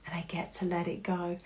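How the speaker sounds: very soft, low, near-whispered awe; acted whispery epiphany; hushed realization. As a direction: let it dawn on you under your breath. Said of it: "that moment of realization of going".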